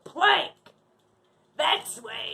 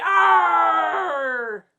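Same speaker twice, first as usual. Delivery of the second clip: pirate growl. Acted. Pirate host growls to kids.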